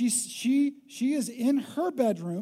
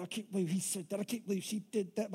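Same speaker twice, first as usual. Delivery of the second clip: much softer, brooding under the breath; muttered inner thought; 2 semitones lower, 7 dB quieter, faster than usual. Retold or imitated. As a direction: replay the grudge to yourself, quietly. Angry thoughts keeping you awake.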